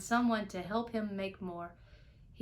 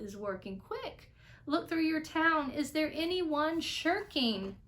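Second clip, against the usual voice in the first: calling back; 6 semitones higher than usual. Acted. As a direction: call down urgently, pressing him. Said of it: "And Horton called back".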